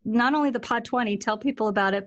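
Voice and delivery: ordinary talk, nothing marked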